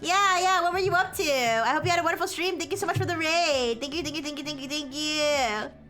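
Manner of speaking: high, bright cute greeting voice; cute anime streamer. Real, not acted. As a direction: squeal hellos to the raiders. Greeting raiders again.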